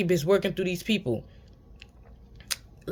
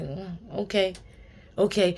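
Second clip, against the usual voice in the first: softly murmured, unbothered okay; unbothered monk; 6 dB quieter than usual. Retold or imitated. Monk-like person just shaking their head at you, unbothered. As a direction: lightly imitate a calm, monk-like acknowledgment. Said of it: "monk-like people or those people that just look at you".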